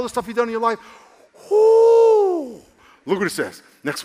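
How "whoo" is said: high, loud joyful whoop; excited whoop. Real, not acted. Preacher celebrating God's faithfulness, loud high whoop. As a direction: let out a big celebratory whoo.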